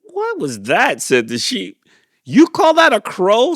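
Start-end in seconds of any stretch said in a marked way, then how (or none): 0.0-1.0 high, loud scoff; scoffing sheep. A narrator's reading. Sheep scoffing at the failed crow, loud high. 2.2-3.6 very high, loud jeer; mocking cow. A narrator's reading. Cow jeering at Clyde's crow, high loud mock.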